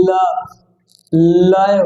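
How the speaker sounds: "লাভ" and "লয়" with deep, stressed first l. Shown as a demonstration. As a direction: press deeply into the l of "লাভ" and "লয়". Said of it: "গভীর করে বলতে হবে".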